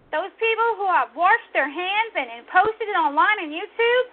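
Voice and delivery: loud, high-pitched scolding; angry rebuke. Real, not acted. Host rebuking those who washed their hands, angry shout. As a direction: denounce them at full voice. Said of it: "I'm so angry".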